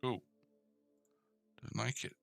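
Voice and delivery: usual speaking voice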